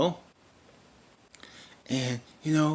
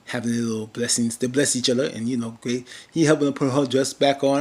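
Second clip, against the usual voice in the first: usual speaking voice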